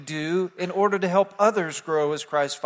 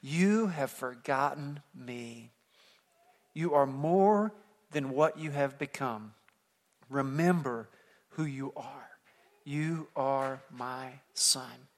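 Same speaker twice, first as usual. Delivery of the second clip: slow, softer, a hint of a solemn ghostly father; Mufasa's spirit; 3 semitones lower, 7 dB quieter, slower than usual. Mufasa's spirit speaks to his son Simba, slow and softer. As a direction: speak slowly down from the clouds. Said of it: "and he says to him".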